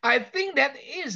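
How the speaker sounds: high, laughing tease. Real, not acted. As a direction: joke through a laugh. Joking about frozen student.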